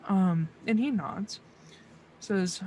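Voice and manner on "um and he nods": usual speaking voice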